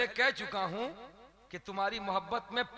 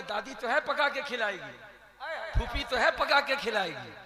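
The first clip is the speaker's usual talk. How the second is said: mocking listing; 5 semitones higher, 9 dB louder than usual. Mocking widower's excuses.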